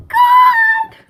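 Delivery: high, loud cry of pure joy; screaming with excitement; excited scream. Real, not acted. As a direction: shriek it with delight. Screams on getting her package.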